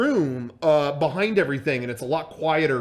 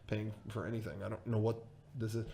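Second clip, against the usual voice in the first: quick, very soft, low aside; hushed, hurried thinking aloud; inner thought; 6 semitones lower, 13 dB quieter, faster than usual. Retold or imitated. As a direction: mutter it privately to yourself. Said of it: "in my head, I'm like".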